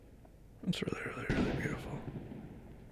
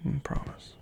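hushed, tender whispered promises; soft whisper; 3 semitones higher, 8 dB quieter than usual. Acted. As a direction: whisper reassurances close in. Soft lover's reassurance whispered.

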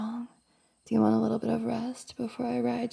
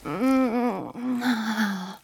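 ordinary delivery

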